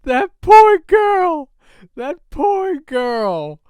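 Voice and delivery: slow, high, loud mock pity; drawn out, over-the-top sympathy. Real, not acted. Feeling for the girl on the date, slow pitying cry.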